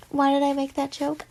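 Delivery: ordinary delivery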